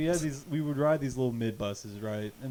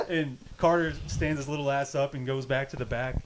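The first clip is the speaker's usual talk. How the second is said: ordinary talk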